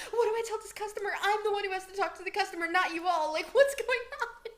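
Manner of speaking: high, loud and fast, freaking out; panicked. Retold or imitated. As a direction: rattle it off in a rising panic. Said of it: "I'm, like, freaking out".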